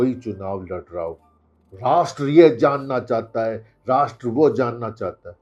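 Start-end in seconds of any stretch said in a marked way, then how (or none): 1.7-5.4 loud, high-pitched mock anchor shout; mock TV-debate shouting, easing slightly on the repeat. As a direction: bellow it like a combative debate-show host. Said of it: "TV का anchor ऐसे चिल्लाता है".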